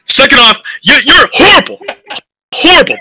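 ordinary talk, nothing marked

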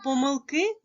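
"помилки" rising high onto stressed "ки"; last-syllable stress. Shown as a demonstration. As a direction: lift your pitch onto the final "ки" of "помилки". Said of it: "має один наголос". ("помилки" з високим наголосом на останній склад "ки")

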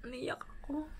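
whispered, choked with tears; tearful whisper. Real, not acted. Daughter recalling her dying father, tearful whisper. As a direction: barely breathe the words out, close to crying. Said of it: "talagang naiyak talaga ako".

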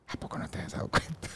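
breathless whisper, barely voiced; sheepish whispered question; whispered reply. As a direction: hush it like a confession. Retelling his nervous reply to mom.

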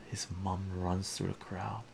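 ordinary delivery

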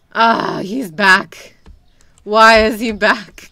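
very loud, dismayed outburst; alarmed yell. Real, not acted. Shopkeeper seeing the unwanted customer return, alarmed yell. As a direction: cry out in exasperated alarm.

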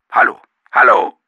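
sharp, impatient hello on the phone. Acted. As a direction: call out, annoyed at the silence. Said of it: "rührte es ungeduldig aus der Leitung".

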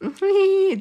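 high bright chuckle; high laugh. Real, not acted. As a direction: laugh at the inside joke. Teasing viewers.

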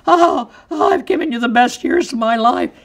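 high, loud hammed-up wounded wife; wronged wife. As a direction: play the martyr, over the top. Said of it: "The half of me is going".

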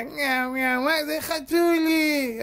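put-on babyish cooing. Preacher mocks a man calling a kitten, slow high coo. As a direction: coo it like someone who never saw a cat. Mimicking a naive cat-caller.

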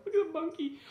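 high delighted call; excited. Real, not acted. Excited pointing.

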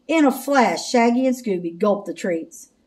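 usual speaking voice

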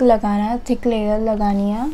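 fingers brushing lips while talking; talking while applying face mask; speaking with hand near mouth. Real, not acted. Seen 0: Fingers spread mask near lips and chin from 1.1 s.

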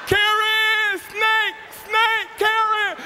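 very high, loud panicked scream; comic full-throated shriek; screamed yell for help. Retold or imitated. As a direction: scream for your wife in hammed-up terror. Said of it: "screamed and yelled for my wife".

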